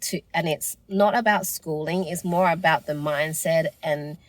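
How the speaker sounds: ordinary delivery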